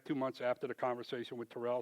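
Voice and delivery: ordinary talk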